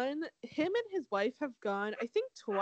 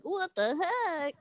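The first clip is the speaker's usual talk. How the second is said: high-pitched incredulous group reaction; mock disbelief; 8 semitones higher than usual. Recapping the men's reaction to the returning contestant, mock disbelief. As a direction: put on baffled surprise, pitched up.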